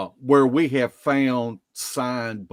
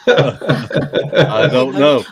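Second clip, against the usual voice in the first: laughing; 9 dB louder, much slower than usual. Real, not acted. Laughs at the Bigfoot question.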